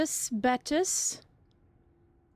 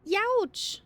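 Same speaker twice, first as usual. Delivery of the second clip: surprised yelp; 8 semitones higher than usual. Real, not acted. Crash seen, reacting.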